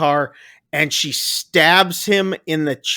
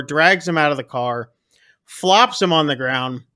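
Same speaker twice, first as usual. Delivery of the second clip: normal talking voice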